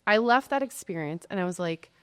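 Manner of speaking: ordinary talk, nothing marked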